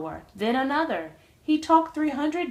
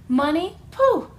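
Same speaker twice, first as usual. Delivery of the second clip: laughing it off; 6 semitones higher, 7 dB louder than usual. A narrator's reading. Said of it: "the glunk just laughed".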